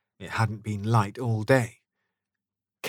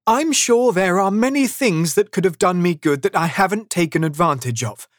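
higher, animated, heartfelt voice; earnest nephew; 8 semitones higher, 6 dB louder than usual. A narrator's reading. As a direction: make the case to your uncle.

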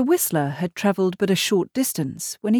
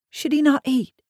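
high-pitched, worried question, voiced rather than whispered; raised voice, anxious concern; 8 semitones higher than usual. A narrator's reading. Wife worries over the sleeping child, anxious. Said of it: "Megan whispered anxiously".

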